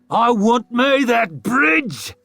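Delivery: slow, high, loud snarl; war chief craving the bridge, snarling. A narrator's reading. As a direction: snarl like a wolf baring teeth. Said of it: "snarled the great wolf".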